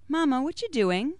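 put-on high, small child voice; a little kid tugging at mama; curious little child; child asking.

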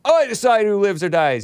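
high, mocking quote; mock self-appointed god. Woman playing God over life, high mocking voice. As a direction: put on a high, grandiose mock voice.

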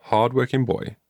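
ordinary delivery

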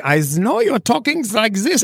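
put-on a teasing British accent; mock British accent. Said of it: "you could do it like".

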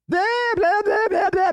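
high mocking blah blah of hyenas; squeaky high hyena babble; yelling hyenas. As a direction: yap it out high like a cackling hyena pack.

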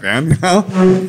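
slow, high laugh through the words; drawn-out laughing aside. Real, not acted. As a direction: laugh it out, savoring the memory. Relishing post-shift beer.

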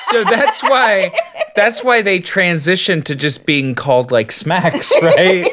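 high-pitched talk through laughter; amused laughing. Real, not acted. Co-host works out the cereal's name change, laughing. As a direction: let real laughter push the voice up.

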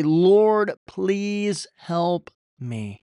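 loud high cry of Lord, then a slow pleading please help me. Pretend. Preacher modelling a prayer in trouble, crying out then pleading. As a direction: cry out to God, then beg slowly. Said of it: "Do you stop and say".

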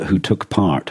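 normal talking voice

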